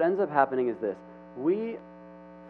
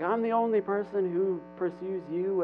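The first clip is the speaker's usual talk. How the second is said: higher-pitched mock whine; acted self-righteous venting, pitched up; complaining; 7 semitones higher than usual. Elijah giving God a speech, high mock complaint. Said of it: "he's kind of complaining to God".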